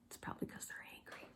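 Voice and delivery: confiding whisper to the audience; whispered aside. Acted. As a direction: whisper it to the kids. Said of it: "aside to listeners".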